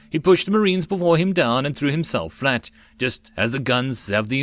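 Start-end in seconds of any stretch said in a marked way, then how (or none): none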